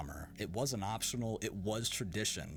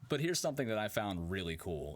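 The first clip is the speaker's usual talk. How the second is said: ordinary talk, nothing marked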